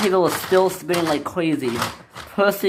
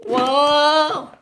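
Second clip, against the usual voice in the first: long high whoa; drawn-out, high-pitched holler; alarmed whoa; 11 semitones higher, 3 dB louder than usual. Acted. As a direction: wail it while hurtling along. Engine hurtling along.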